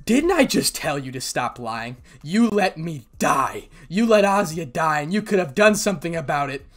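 loud, bitter reproach; angry accusation. Acted. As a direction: lay the blame on her forcefully. Issei accuses Rias of betrayal.

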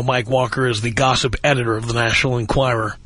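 ordinary delivery

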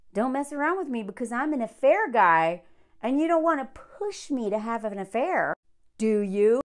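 usual speaking voice

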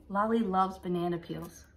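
ordinary talk

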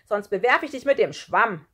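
loud, defiant warning; brave threat. A narrator's reading. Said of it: "rief er mutig".

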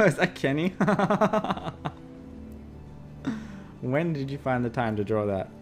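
ordinary talk, nothing marked

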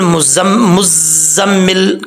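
मुज़म्मिल slow, z and m held; careful model. Shown as a demonstration. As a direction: draw out the doubled sounds in मुज़म्मिल. Teacher models the word carefully.

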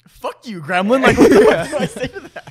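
high-pitched retort breaking into laughter; laughing mock outrage. Guest retells being roasted by a ten-year-old, laughing mock outrage. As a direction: act indignant, cracking up as you go.

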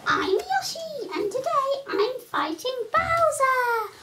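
high, bright cartoon dinosaur voice; Yoshi voice. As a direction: play the cheerful little Yoshi, pitched high. Kid voices Yoshi toy.

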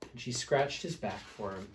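ordinary delivery

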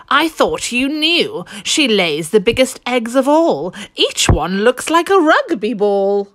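high, bright-eyed goose voice. A narrator's reading. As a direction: play the goose telling a secret. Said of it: "The goose replied".

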